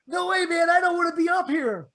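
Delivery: too scared to get up; spooked. Retold or imitated. Scared friend refusing.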